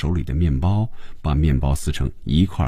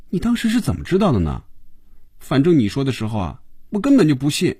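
high, lively questioning voice; curious female character; 8 semitones higher, faster than usual. Acted. Phoebe asks how he knew back then, high curious role voice. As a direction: ask in a high voice, frankly doubtful.

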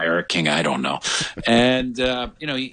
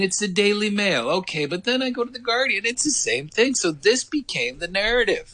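ordinary delivery

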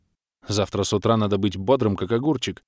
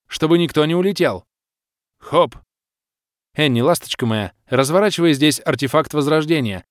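high, raised, forceful female leader; commanding baroness; 6 semitones higher, 4 dB louder than usual. A narrator's reading. Baroness ordering her fire mages, commanding. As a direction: issue battle orders with authority.

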